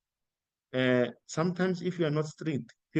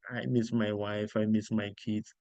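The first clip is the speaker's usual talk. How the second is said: soft homesick sigh; 5 semitones lower, 5 dB quieter than usual. Retold or imitated. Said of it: "I'm a sissy boy".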